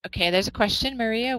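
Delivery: ordinary talk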